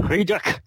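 put-on Friedrich said the old German way; old world German pronunciation. Shown as a demonstration. Guest correcting the host on his surname, old German pronunciation. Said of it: "the old world German pronunciation".

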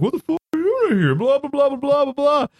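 high-pitched jeering gibberish; mock jeering. Teammates mocking a guy in the shower, jeering. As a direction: imitate teammates taunting, sliding into blah-blah. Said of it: "start making fun of him".

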